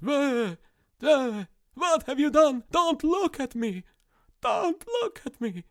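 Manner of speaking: high, slow wailing horror at his reflection; hammed-up shriek of dismay, stretched out slow; mock horror.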